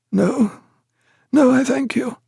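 high, weak-voiced sick man; ailing patient. A narrator's reading. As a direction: decline help in a high, halting voice. Sick man declines doctor's help.

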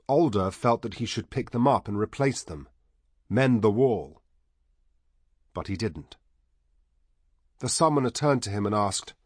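usual speaking voice

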